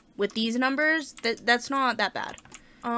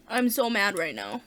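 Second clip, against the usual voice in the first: ordinary delivery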